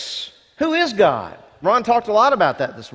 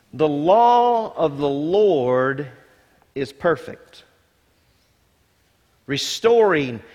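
scripture reading; 3 semitones lower, slower than usual. Acted. Reading scripture slowly aloud.

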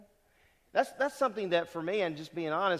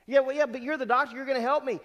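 higher, louder and quick, protesting to the doctor; pleading patient; 9 semitones higher, 5 dB louder, faster than usual. Retold or imitated. Patient hearing bad news, pleading with the doctor, higher and faster. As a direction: blurt it out like a worried patient clinging to hope.

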